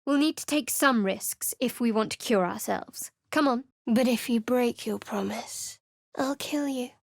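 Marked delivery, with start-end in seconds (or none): none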